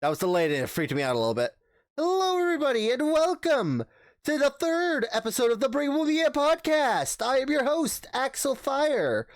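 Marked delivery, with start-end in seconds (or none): 2.0-9.3 high, bright host intro; podcast announcer. Acted. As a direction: pump up the show opening.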